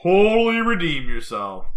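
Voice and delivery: loud, high, drawn-out "totally"; mock proclamation. Mock proclamation after the story.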